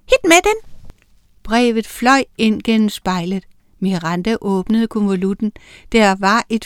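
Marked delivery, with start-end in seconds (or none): 0.1-0.7 high, loud demand; shout. A narrator's reading. Miranda reaches for the letter, shouting. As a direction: call it out sharply, pitched right up. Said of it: "og råbte".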